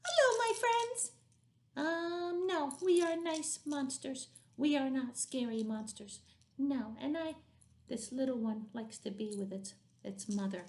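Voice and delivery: put-on a friendly little puppet talking to the kids; sweet puppet voice; finger puppet voice. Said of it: "Let Miss M get the small one".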